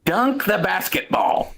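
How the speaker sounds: high, drawn-out coaching command; Coach Hansen. Acted. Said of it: "I'll do it in the Coach Hansen voice".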